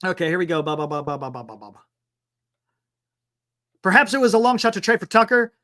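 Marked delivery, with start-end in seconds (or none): 0.6-1.8 rapid run of "ba" sinking lower and softer; idle "ba-ba-ba" filler. Real, not acted. Host finding his place in the article, idle babbling. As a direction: babble syllables while finding your place, trailing down.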